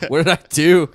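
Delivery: mock bewildered. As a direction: protest innocently. Hit with milkshake, bewildered.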